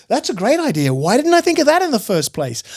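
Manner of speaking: high-pitched retold realization; mock amazement. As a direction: act out a sudden delighted change of mind. Acting out sudden new enthusiasm.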